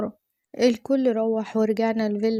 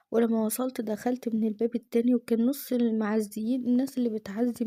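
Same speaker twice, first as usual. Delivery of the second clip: ordinary delivery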